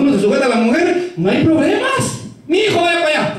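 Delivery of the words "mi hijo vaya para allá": high-pitched mock wife giving orders; bossy wife. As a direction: order him about in a raised pitch.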